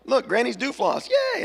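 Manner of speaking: put-on a kid cheering over her win; Bluey shows Bingo, squealing with excitement; excited child. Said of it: "she shows Bingo".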